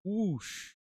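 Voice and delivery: high, swooping whoosh; whoosh sound. Pretend. Counting down a drop, then a high whoosh. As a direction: make a mock whoosh as the model drops. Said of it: "So in three, two, one".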